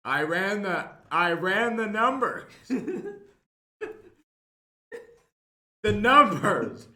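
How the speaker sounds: smug mock expertise. Player hamming up fake math.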